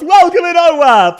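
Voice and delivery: high, loud welcome to chat; excited greeting. Real, not acted. Greeting a new chatter, loud and high. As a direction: sing out the welcome.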